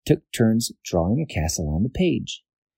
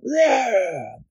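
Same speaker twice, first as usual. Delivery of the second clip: monster roar; 13 semitones higher than usual. Acted. Monster roars in the net.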